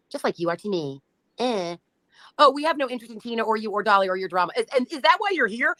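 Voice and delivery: usual speaking voice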